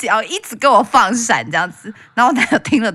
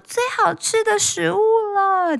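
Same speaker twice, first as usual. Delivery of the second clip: much higher, sweet imitating of a granny's praise; doting old lady; 12 semitones higher than usual. An old wife praising the chicken to her husband, sweetly gushing. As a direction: gush over the food like a spoiled granny. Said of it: "还会说".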